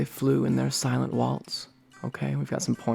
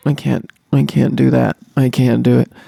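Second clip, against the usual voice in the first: much louder, insistent protest; loud refusal; 10 dB louder than usual. Acted. Presenter balking at the presentation, loud protest. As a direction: burst out loudly, refusing to go on.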